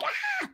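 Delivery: a thin squeal of a scream, hammed-up; mock high scream. Shown as a demonstration. Showing how she did not scream. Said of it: "Not like".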